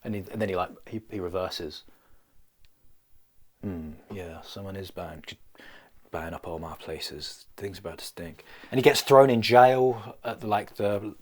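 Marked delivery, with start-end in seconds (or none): 3.6-8.4 gritty hero voiceover. Pretend.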